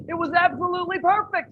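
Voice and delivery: ordinary delivery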